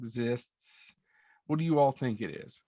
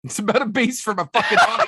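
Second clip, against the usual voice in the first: fast, loud, laughing through the words; cracking up while ranting; 7 semitones higher, 7 dB louder, faster than usual. Real, not acted. Mock outrage at cave movie.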